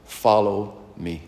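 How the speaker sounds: slow, soft invitation as Jesus; a gentle voice of Jesus calling; Jesus speaking. Acted. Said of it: "I want you to hear Jesus saying this".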